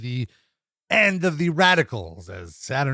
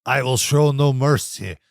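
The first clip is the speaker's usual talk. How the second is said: slow mock Eastern European accent, not quite Russian; hammed-up Eastern European villain accent; weird Eastern European accent; 4 dB louder, slower than usual. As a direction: play a strongman with a vague Eastern European accent. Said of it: "Very weird Eastern European accent".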